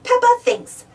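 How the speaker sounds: plain reading voice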